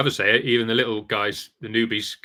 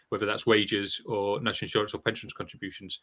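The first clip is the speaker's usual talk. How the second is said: normal talking voice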